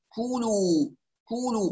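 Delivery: "كلوا" with both u vowels completed and drawn out; full u vowels. Shown as a demonstration. Teacher models for students, full u vowels. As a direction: round out each vowel of "كلوا". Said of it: "وإتمام الضمات".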